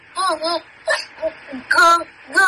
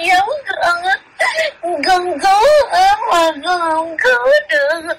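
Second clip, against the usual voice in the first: tearful cry rising to a shout; sobbing cry. Acted. Child spirit crying out that it failed to save them, sobbing. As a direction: wail it out through tears.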